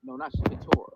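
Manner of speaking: faint muffled call audio; garbled like talking underwater. Real, not acted. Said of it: "you sound like you're underwater".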